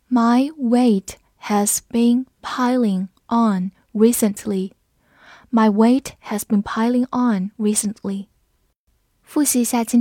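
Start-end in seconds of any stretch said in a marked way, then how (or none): 0.1-4.7 slow, word-by-word teacher reading; slow read-along. Shown as a demonstration. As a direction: say it slowly with a gap after each word. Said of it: "我们慢读一遍". (慢读，每个词分开念)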